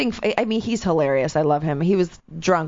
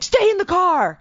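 high, sharp mock cop order; mock cop ordering them to stay put, high and sharp; cop command; 11 semitones higher, 4 dB louder, slower than usual. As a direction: bark it like a cop, pitched way up. Cops barking orders.

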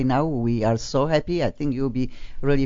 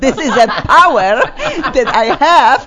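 laughing; 10 semitones higher, 7 dB louder than usual. Real, not acted. Laughing while telling about accent.

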